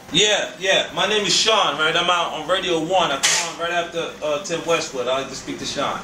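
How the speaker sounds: normal talking voice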